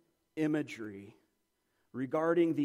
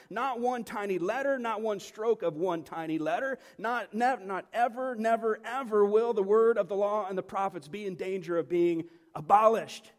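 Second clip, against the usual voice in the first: forceful emphatic denial; 3 semitones higher, 4 dB louder than usual. Acted. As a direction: drive it home, piling denial on denial. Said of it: "most forceful way possible".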